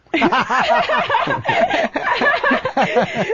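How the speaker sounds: long, high-pitched cackling laugh. Real, not acted. Host cracks up at his own story, high cackle. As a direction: break up laughing at your own joke.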